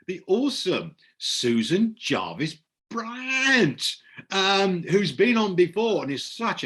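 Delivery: high-pitched, delighted announcer build-up; gushing host introduction. Real, not acted. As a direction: introduce a star guest, stretching the name. Host introduces next guest.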